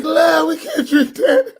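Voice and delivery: high, near-crying, slow refusal; whiny horrified refusal. Retold or imitated. Sheikh begging not to drink the coffee, high whimpering refusal. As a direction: whimper it out like a wounded man. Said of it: "he was like".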